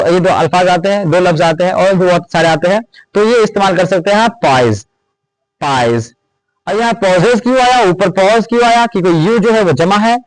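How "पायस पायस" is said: पायस said low and falling, "pai-as"; saying pious. Shown as a demonstration. Teacher showing students how to say पायस. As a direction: repeat पायस slowly, low and clear. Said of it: "पायस आता है".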